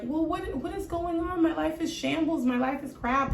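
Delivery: high-pitched fretful complaining; mock exasperation. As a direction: imitate someone overwhelmed by their life. Acts out people's constant complaining.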